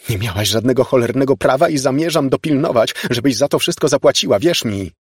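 indignant, forceful accusation; furious tirade. Acted. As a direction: lay down the law, loud and insistent. Elizabeth's furious tirade at ghost.

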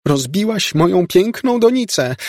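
bardzo wysoki lament z wyrzutem; zawodź teatralnie nad stratą; przesadna rozpacz. A narrator's reading. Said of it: "z przesadną rozpaczą". (przesadnie zrozpaczona ciotka)

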